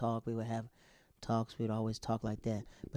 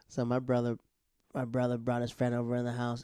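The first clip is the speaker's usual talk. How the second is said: ordinary talk, nothing marked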